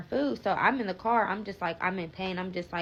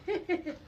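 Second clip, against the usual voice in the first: short disbelieving laugh; 10 semitones higher, 7 dB quieter than usual. Real, not acted. Drive-thru closed again.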